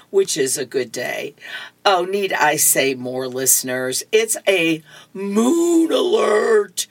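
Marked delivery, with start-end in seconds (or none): 4.1-6.9 drawn-out, higher-pitched mock warning; mock-dramatic announcement. Warning dear listeners of a moon alert, mock-dramatic. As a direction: stretch out MOON like a playful alarm.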